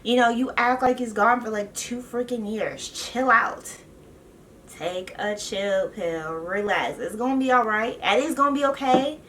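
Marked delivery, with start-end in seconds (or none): 4.7-7.0 softer and slow, calming; soothing. Real, not acted. Calming Leah down, soft and slow. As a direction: ease her down gently. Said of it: "calming repeat".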